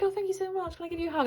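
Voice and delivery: retold tearful gratitude, pitched very high; tearful thanks. Customer asking the shop assistant for a hug, mildly mock-tearful. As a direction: re-enact being touched, in a high voice. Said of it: "And I got tearful".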